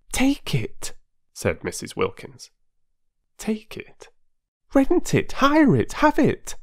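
high, rushing, pleading voice of an excitable wife; eager Mrs Wilkins. A narrator's reading. Mrs Wilkins urging a stranger to escape, eager and high.

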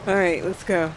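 usual speaking voice

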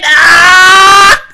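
high, loud cry; real anguished shout. Real, not acted. Viewer crying out at the screen.

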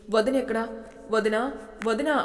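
calling out for a missing sister-in-law; loud call. A narrator's reading. Man searching the house for his sister-in-law, calling out loudly. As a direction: raise your voice, repeating the call. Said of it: "అంటూ గట్టిగా అరిచాడు".

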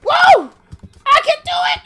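very high, loud whooping cry; triumphant shriek. Real, not acted. Player cheering their own climb, very high yell. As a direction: shriek it at the top of the range.

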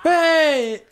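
high, loud indignant yell; loud shout. Real, not acted. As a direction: shout out at the attacker. Hit by hairspray fire.

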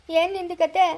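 ordinary talk

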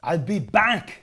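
put-on slow Austrian-accent I'll be back, drawn out; lightly voiced Austrian movie-star line; slow Arnold catchphrase. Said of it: "you've done it again".

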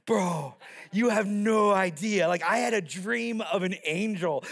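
drawn-out surfer dude delivery. Acted. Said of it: "he was a surfer".

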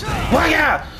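high, loud attack yells; battle cries. Pretend. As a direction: rattle off hyped fighting noises at the top of your voice. Battle cries during fight.

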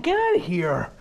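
ordinary delivery

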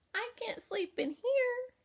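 high, loud little-bug voice; the ladybug whining to the kangaroo, high and squeaky. A narrator's reading. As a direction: complain in a tiny squeaky ladybug voice. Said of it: "cried the lazy ladybug".

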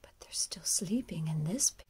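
ordinary delivery

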